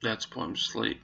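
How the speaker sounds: quiet, grieving, close to tears; choked up. Real, not acted. Owner recalling the dog being put down, fighting tears. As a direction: force it out softly through real grief. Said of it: "I'm trying not to cry".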